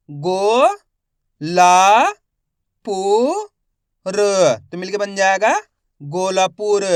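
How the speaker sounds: slow spelling drill, each syllable held with a rising end; stretched syllables for beginning readers; letter-by-letter sounding out. Shown as a demonstration. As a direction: sound out each letter, then blend the word. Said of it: "एक एक अक्षर का उच्चारण कर रहा हूँ".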